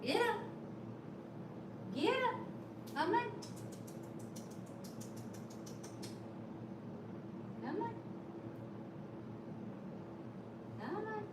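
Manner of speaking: soft, high, cooing encouragement to the cat; pet talk. Real, not acted. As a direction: coax the cat to play, sweet and soft. Talking to her pet.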